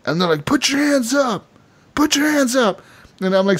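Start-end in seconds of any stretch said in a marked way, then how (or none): 0.4-2.8 high barked command; mock police shout. Policemen arriving to arrest the player, high barked command. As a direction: bark it like a lawman drawing on you.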